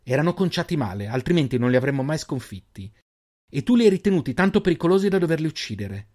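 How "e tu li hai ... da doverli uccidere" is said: indignant. Acted. Erin argues with Zalen.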